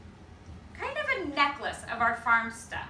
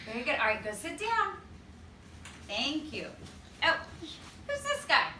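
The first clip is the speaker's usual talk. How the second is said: ordinary delivery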